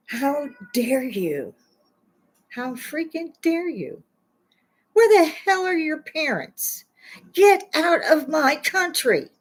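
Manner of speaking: slow, high, angry demands; slow furious scolding of protesters; outraged. Real, not acted. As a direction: spit each word out in disgust. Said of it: "it gets my dander up".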